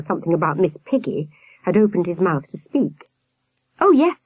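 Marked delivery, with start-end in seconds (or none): 3.8-4.2 quick, high, louder answer; hasty reply. A narrator's reading. Sam covering for the twins, hasty reply. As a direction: blurt it out quickly, pitched up. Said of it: "he said quickly".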